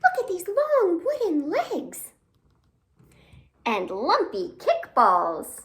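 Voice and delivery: excited wonder. A narrator's reading. Storyteller marvels at the objects in the snow, excited. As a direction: show the kids something amazing. Reading wonder-filled picture book.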